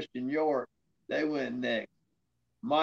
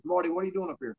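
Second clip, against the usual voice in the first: surprised boss; faster than usual. Retold or imitated. Boss questioning him backstage.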